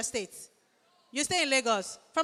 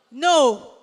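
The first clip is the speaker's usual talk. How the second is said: loud denial; 9 dB louder than usual. Real, not acted. MC answering crowd guess.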